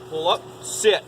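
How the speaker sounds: ordinary talk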